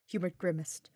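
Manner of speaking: usual speaking voice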